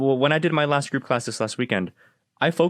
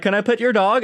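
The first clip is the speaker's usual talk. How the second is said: higher-pitched mock stranger's question; pushy stranger; 8 semitones higher, 3 dB louder than usual. Dog owner mimics strangers asking to pet, higher-pitched imitation.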